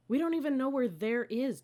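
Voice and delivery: high and loud, fed up; exasperated. Acted. Jack arguing with his sister, exasperated outburst. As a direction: argue back in frustration.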